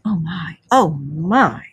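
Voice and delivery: breathless, lightly voiced Mole; awed murmur. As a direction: Mole still marvelling over the picnic. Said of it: "still gasping".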